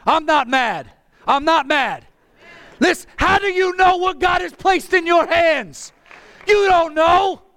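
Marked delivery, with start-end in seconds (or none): 2.8-7.4 high, impassioned pulpit shout; preaching at a yell, pitch raised; yelling preacher. Real, not acted. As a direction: cry the question out to the whole church. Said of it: "I have to yell".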